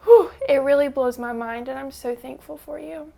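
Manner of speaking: pulling herself together after the whisper, still teary; tearful release. Real, not acted. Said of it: "I'm gonna cry".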